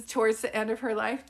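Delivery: ordinary talk, nothing marked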